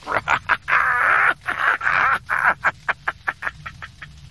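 high, loud villainous cackle, tailing away; maniacal laugh. A narrator's reading. Emperor exulting over dying Luke, maniacal cackle. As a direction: cackle madly at length. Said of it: "He laughed maniacally".

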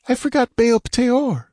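high, alarmed female character voice; a sudden worried remembering, pitched high; frantic woman. Acted.

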